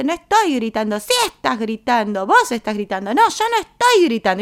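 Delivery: loud, high back-and-forth yelling; acted shouting match. Two people arguing over who is shouting, acted yelling. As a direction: escalate into an indignant high-pitched shout. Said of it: "alzando la voz".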